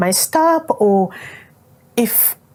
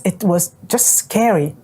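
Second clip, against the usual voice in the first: ordinary talk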